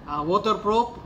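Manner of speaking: ordinary talk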